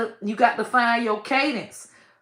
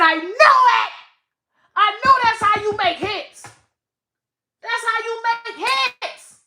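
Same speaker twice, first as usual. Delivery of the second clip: excited realization; 12 semitones higher than usual. Retold or imitated. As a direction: crow it like you cracked the code. Retelling her own excited realization.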